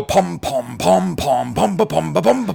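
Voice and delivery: oompah pom-poms in rhythm; village band imitation. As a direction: mimic a brass band with your voice. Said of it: "like the Marlin Spike Village Band".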